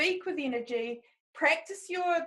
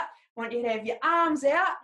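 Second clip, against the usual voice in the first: ordinary talk, nothing marked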